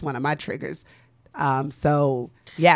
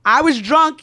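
emphatic admission; 9 semitones higher, 6 dB louder than usual. Real, not acted. Admitting being drunk.

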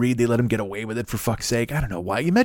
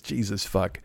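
ordinary delivery

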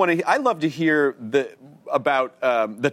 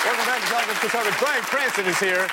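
host lightly doing a raised put-on Cranston voice; a playful higher impression of the guest; mock Bryan Cranston impression; 6 semitones higher than usual. Host teasing the guest with a mock impression. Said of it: "doing an incredible Bryan Cranston impression".

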